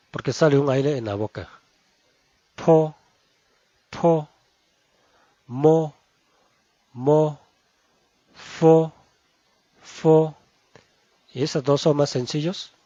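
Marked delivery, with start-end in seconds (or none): 2.6-10.4 po mo fo said crisply, each twice; Mandarin initials. Shown as a demonstration. Teacher drills po mo fo for the class. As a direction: model po, mo and fo clearly. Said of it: "ese se pronuncia".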